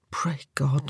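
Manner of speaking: breathless whispered plea; a hushed whisper to God; whispered prayer. Acted. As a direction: barely voice it, begging God. Wounded man's desperate inner prayer.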